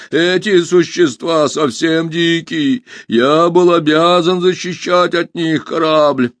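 a touch of sad robot; mildly mournful robot. A narrator's reading. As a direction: complain in stretched machine phrases. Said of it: "печально заметил робот".

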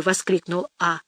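ordinary delivery, nothing marked

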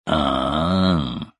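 understated imitation of an awed sigh; mock admiring cry. Guest mimicking the admiring cries translators rendered in Chinese, understated mock awe. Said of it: "восхищённо вскрикивали".

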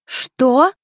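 loud, high, swooping question; stunned "what?" shooting upward; taken aback. A narrator's reading. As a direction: blurt it in astonishment. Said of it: "опешила я".

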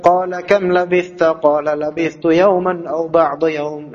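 qala kam labithta read slowly, joined without stopping; connected reading. Shown as a demonstration. Teacher demonstrating wasal, the linked reading. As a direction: join it all in one breath, slowly. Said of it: "Menyambung wasal".

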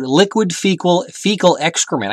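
measured, emphatic quoting; quoted phrase. Retold or imitated. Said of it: "He said, quote".

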